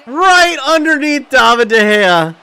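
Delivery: high, loud outburst falling away; exasperated call. Real, not acted. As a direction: cry out at the keeper's blunder. Exasperated call of keeper's miss.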